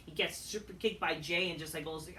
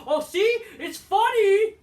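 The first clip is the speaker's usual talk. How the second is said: sarcastic yell; 12 semitones higher, 12 dB louder, slower than usual. Real, not acted. Mocking a joke he dislikes.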